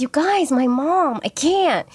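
a hint of a whiny good girl; whiny teen excuse. A teen begging off to her friends, lightly whiny. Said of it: "my phrase was always".

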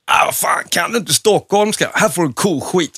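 hint of a cheeky country lad; farm boy voice. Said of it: "bondkillen till, till Lasse Åberg".

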